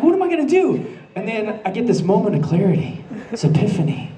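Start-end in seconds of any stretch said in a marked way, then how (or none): 0.0-0.9 high, laughing cry of panic; mock panic. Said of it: "So I'm freaking out".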